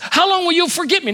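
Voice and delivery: high, fast anguished cry to God; a hint of David's despairing cry; lightly voiced crying out.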